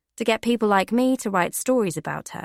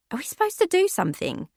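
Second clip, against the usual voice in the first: much higher, fast worried question; anxious Becky; 10 semitones higher, faster than usual. Acted. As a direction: rush it in nervous worry. Becky anxious at strange machine.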